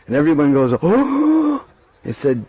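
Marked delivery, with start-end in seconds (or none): 0.8-1.6 high startled oh; shocked gasp. Acted. Gopis hearing Kutila approaches, startled. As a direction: gasp all together. Everyone gasps.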